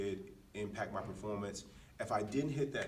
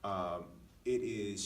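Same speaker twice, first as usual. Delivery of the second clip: ordinary talk, nothing marked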